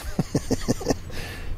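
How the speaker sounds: breathy suppressed giggle; stifled laugh. Real, not acted. Watching the Karen walk off, a hushed giggle. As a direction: laugh under your breath.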